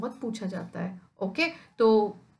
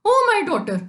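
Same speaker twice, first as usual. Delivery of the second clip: much higher, louder cry for the daughter; mock wail; 7 semitones higher, 7 dB louder, much slower than usual. Teacher acts Shylock yelling for his daughter, mock wail. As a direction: cry it out in loud grief. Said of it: "चिल्ला रहा है".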